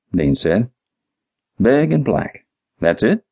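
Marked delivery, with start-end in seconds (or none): 1.6-3.2 incredulous echo. Acted. Detective echoes clerk's useless answer, incredulous.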